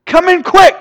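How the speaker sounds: high, loud cry for help; panicked shout. Acted. Son grabbing a man in a lab coat, panicked shout. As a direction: shout it urgently at full pitch. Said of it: "he, he freaks".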